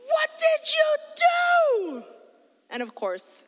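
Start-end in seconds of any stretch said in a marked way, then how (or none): 0.1-2.0 slow, high, loud demand; angry yell. Retold or imitated. Big sister yelling at her brother over the bill. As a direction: drag out each word in disbelief.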